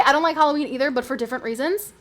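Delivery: ordinary talk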